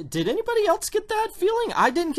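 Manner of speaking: very high-pitched baffled question; high, incredulous appeal to the audience; comic disbelief. Acted. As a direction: squeak it out in mock bewilderment.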